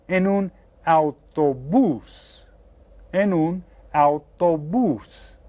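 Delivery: "autobús" slow with the stress on "bús"; stress on last syllable. Shown as a demonstration. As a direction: lean slowly on the final "bús". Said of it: "you hear how this last syllable is stressed".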